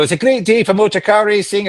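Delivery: high, bright Scottish brogue; a race-car Scotsman in a lightly done accent. Retold or imitated. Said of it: "maybe I did a Scottish accent".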